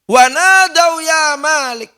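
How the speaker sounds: slow, high, loud cry for help; pleading shout. Acted. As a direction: call out desperately, stretching it. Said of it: "berteriak-teriak merayu".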